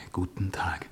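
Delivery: Guten Tag answered in a soft whisper; whispered reply. A narrator's reading. Glan answers Edwarda's greeting in a hushed whisper. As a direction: murmur Guten Tag back to her. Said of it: "und ich antwortete".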